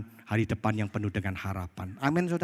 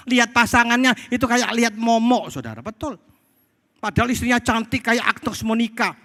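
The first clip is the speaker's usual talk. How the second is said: high, emphatic sermon voice; insistent, high-pitched preaching; full-force preaching; 12 semitones higher, 7 dB louder than usual. Real, not acted. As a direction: drive it home to the congregation, voice raised high.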